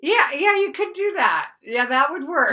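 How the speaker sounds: overeager, high and loud yes; hammed-up desperate mother agreeing; acted frantic mess. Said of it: "how much of a mess I was".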